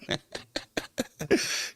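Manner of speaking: high wheezing giggle with no voice; a silent wheeze of laughter; breathy silent laugh. Real, not acted. Streamer wheezes with laughter at chat.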